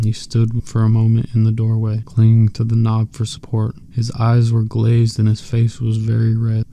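ordinary delivery, nothing marked

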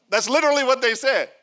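eager emphatic aside; excited insistence. Real, not acted. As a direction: punch it out with delight.

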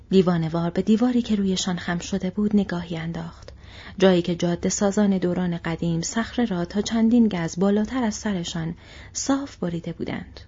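ordinary delivery

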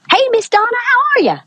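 high, sing-song East Tennessee hello; imitated friendly Tennessee local, very high; East Tennessee greeting. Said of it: "I fall into the accent".

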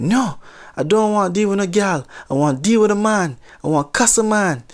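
put-on retelling a hot-headed Jamaican customer at the counter, in Jamaican accent. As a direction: imitate an angry Jamaican customer in a light Jamaican accent. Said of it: "maybe I'll just try the accent just a little bit".

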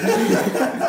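riso curto sem voz; risada abafada. Real, not acted. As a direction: solta uma risadinha sem graça. Risada ao perceber a interrupção. (risadinha abafada)

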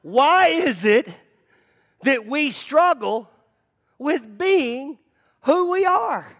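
high, slow preaching at full force. Acted. Pastor challenging his congregation, high, slow preaching. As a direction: drive the question home from the pulpit.

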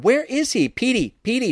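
very high, desperate calling; shrill and desperate. A narrator's reading. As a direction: call for the lost dog in a shrill voice. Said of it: "sounding shrill and desperate".

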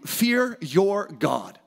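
ordinary talk

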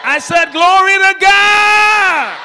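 very high, very loud preacher's cry, last word long drawn out; full-force shout. Real, not acted. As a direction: belt out GOD at the top of your voice. Escalates call to congregation.

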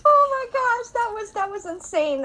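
high, loud, laughing squeal; breathless giddy outburst; excited squeal. Real, not acted. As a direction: gush about how wild it was.

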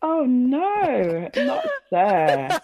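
a hammed-up sorrowful oh no; forlorn oh no. Real, not acted. Reacting to being called sir, mock forlorn. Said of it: "that oh no sound is so forlorn".

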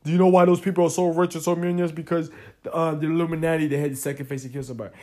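lightly put-on voice passing on a conspiracy rumor; a hint of know-it-all gossip; mock rumor voice. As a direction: imitate people gossiping about the Illuminati, a touch altered. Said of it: "people say, like".

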